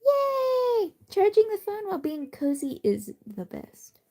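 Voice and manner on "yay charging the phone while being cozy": high squeal of joy; excited cheer. Real, not acted. Happy about cozy charging.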